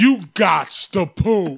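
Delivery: very slow, drawn-out pundit; Stephen A. Smith impression. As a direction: stretch each word like a sports debater. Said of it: "Stephen A. Smith talks you through a poop".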